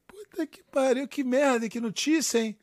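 hammed-up fatherly shock; mock dismay. Pai reage ao namoro da filha. (pai fingindo desespero)

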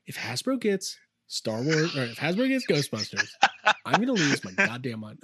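low, deliberate mock ultimatum; self-impression. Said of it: "just me doing my own impression".

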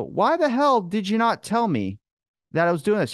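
mock outrage at your buddy; annoyed demand. Confronting his buddy about the plans.

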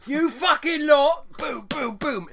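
loud, high, drawn-out mock Yorkshire yell; bad Yorkshire accent. Podcaster acting out an actor beating people up, bad Yorkshire accent. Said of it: "That's not a Yorkshire accent at all".

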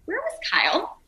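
high-pitched, puzzled questioning; baffled parents. Retold or imitated. Parents phoning after watching the show, baffled. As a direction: ask it high and bewildered.

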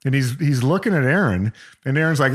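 usual speaking voice

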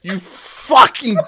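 loud, high furious rant; swearing tirade. Real, not acted. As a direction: rage and shout at them. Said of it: "brought that out of me".

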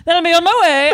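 mock lady voice. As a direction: keep the silly lady voice going.

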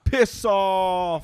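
loud, high mock dismissal; shouted insult. Trying out the UK phrase, shouted. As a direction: yell it like telling someone to get lost.

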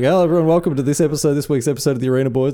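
bright, brisk podcast host intro; mock show intro. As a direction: rattle off the show opening like a host. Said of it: "it's me going".

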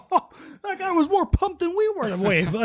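high, laughing disbelief; amused high voice. Retold or imitated. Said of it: "We're like".

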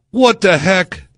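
high, loud indignant cry; outraged disbelief. Real, not acted. Reacting to police taking a cut, indignant. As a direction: exclaim it in outrage.

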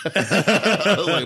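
laughing through the words; real laughter, slow and loud. Real, not acted. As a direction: crack up while telling it. Laughing while retelling the meal.

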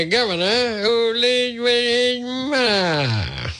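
high, slow, sneering singsong; mocking singsong. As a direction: stretch it out to mock the governor. Running mocking gag on governor.

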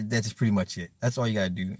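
ordinary talk

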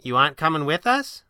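mildly taken aback; surprised. A narrator's reading. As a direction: ask it in surprise. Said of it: "asked, surprised".

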